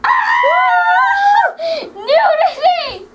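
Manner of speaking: high, slow, laughing shriek. Real, not acted. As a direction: scream it out, laughing.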